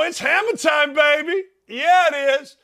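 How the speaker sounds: loud, high show-opening shout; hyped-up announcer. Real, not acted. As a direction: belt it out to launch the segment. Host hypes up the segment intro.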